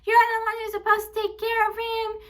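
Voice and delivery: high, mocking sing-song whine of the godmother; high mocking impression of an accusing relative. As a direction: mimic her nagging in a high whiny voice. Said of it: "She's like".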